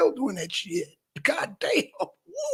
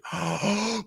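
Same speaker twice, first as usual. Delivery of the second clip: gasp for air; 6 dB quieter than usual. Real, not acted. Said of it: "I'm gonna do a little timer".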